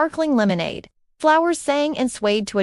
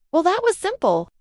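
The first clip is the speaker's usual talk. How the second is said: ordinary delivery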